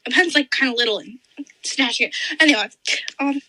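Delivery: usual speaking voice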